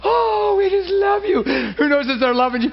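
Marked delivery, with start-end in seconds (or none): 0.0-1.4 high, swooning adoration; gushing worshipper. Retold or imitated. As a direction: coo it like the praying ladies. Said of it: "ladies worshiping".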